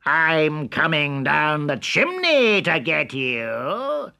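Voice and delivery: high, loud furious wolf; angry wolf shout. A narrator's reading. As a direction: roar the threat at them. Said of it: "he shouted".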